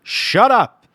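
loud, high retold shout; forceful, slowed-down scolding shout; exasperated yelling. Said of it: "telling Jesse to shut the fuck up".

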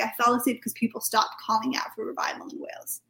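soft whispery giant angel; hushed angel voice. Retold or imitated. Sleepy angel explains.

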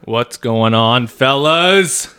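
slow, loud, high hype greeting; drawn-out loud bro greeting; frat bro. Acted. Hyped greeting to buddies.

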